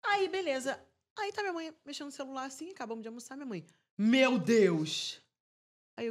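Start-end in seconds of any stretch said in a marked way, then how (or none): 4.0-5.2 shocked exclamation. Retold or imitated. Mother shocked at phone.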